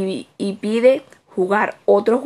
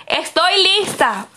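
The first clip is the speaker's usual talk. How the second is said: put-on child calling out, pitched up high; excited call; 8 semitones higher than usual. Little girl calling to her mother that she's ready, high eager call. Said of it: "y grita".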